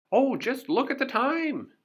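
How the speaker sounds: high, loud exclamation; sudden exclamation. Acted. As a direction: cry out as if suddenly remembering. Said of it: "Mister Brave cried".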